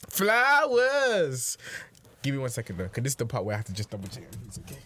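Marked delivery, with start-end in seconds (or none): 0.1-1.6 excited announcement. Acted. Announcing the flowers segment.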